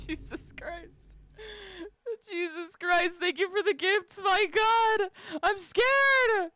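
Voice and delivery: high, stunned gasp of thanks; rising in disbelief; overwhelmed. Real, not acted. Streamer thanking a viewer for many gifts, stunned.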